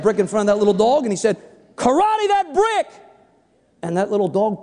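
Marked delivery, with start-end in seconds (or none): 1.8-2.9 high, drawn-out command; mock martial-arts order to a dog. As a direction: call the order out high and stretched. Clerk commands the poodle again.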